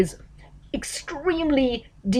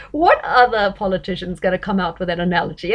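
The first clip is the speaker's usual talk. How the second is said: ordinary talk, nothing marked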